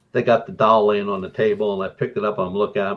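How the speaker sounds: ordinary talk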